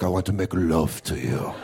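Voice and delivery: lightly voiced French seducer; French lover. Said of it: "In France, they're like".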